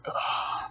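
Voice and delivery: soft, voiceless groan of imitated weariness; put-on exhausted exhale; fed-up sigh. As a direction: breathe out like a man who is over it. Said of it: "you can tell that he's just fucking over".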